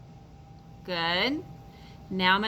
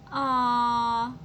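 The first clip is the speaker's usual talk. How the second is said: cooing aw; 3 semitones higher than usual. Acted. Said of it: "I said".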